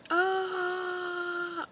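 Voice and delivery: long high "Ah" called out; father shouting behind the waterfall; high held yell. Retold or imitated. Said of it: "he's like".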